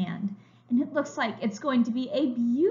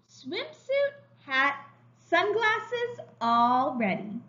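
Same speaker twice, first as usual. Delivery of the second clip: slow, bright storybook reading; reading to children; 7 semitones higher, 4 dB louder, much slower than usual. A narrator's reading. Teacher reading a picture book to young children, slow and bright. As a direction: read it like a picture book for little kids.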